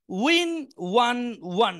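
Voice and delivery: slow, clear vocabulary drill. Shown as a demonstration. Teacher drilling verb forms slowly for the class. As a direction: spell out each form slowly. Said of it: "সবাই একটু মনোযোগ দিয়ে শুনবেন".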